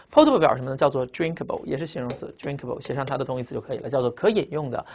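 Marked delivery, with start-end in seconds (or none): none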